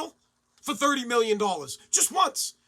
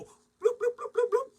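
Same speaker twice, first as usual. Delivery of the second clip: high bloop bloop gulps; gulping sound; 13 semitones higher, slower than usual. Pretend. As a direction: chug it down with cartoon bloops. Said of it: "I'd go".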